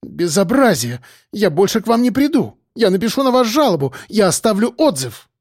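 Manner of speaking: high-pitched indignant patient; high female outrage; outraged woman. A narrator's reading. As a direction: rattle off threats in a high, offended voice. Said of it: "её лицо налилось краской".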